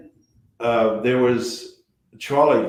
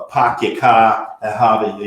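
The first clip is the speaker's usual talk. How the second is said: broad, slowed mock Boston accent; fake Boston accent; 5 dB louder than usual. Shown as a demonstration. Actor mocking other actors' phony Boston accent, broad fake Boston. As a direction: put on an overdone Boston accent. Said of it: "he doesn't do that fake".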